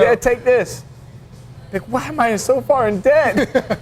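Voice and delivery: high put-on girlfriend voice, slow on the second line; hammed-up girlfriend puzzling over the debt; mock girlfriend. Continues voicing the girlfriend.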